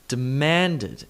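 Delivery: demanded punched on MAN; weak start, strong middle in demanded; de-MAN-ded; second-syllable stress. Shown as a demonstration. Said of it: "emphasis on the second syllable".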